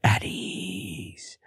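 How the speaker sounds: breathy whisper, barely audible; hushed whispered command. Retold or imitated. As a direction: whisper it so nobody wakes. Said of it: "but I whispered it".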